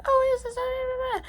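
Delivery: high-pitched gushing babble; squealy put-on enthusiasm; mock excitement. Mocks a newcomer's excited reaction.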